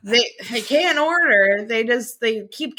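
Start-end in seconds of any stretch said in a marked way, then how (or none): none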